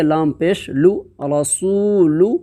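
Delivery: slow, drawn recitation of letters; spelling out syllables. Shown as a demonstration. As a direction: sound out each letter slowly like a Quran teacher. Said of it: "जैसे".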